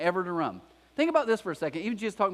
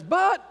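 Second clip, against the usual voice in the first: "But" punched much higher and louder; emphatic but; 13 semitones higher, 6 dB louder than usual. Shown as a demonstration. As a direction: hit "But" hard as the turning point. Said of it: "beautiful "but" in the Bible".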